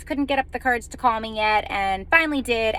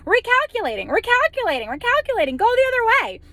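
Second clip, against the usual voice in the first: higher, sing-song robotic repeats, falling each time; mock GPS voice; 11 semitones higher, 4 dB louder than usual. GPS nagging the lost driver, higher mock robotic voice. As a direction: parody the nagging sat-nav, pitched up.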